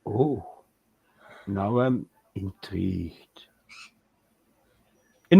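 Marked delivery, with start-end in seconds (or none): 0.0-3.5 low, slow devilish purr. Pretend. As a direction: drop into a sinister devil voice. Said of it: "I shouldn't have used my devil voice".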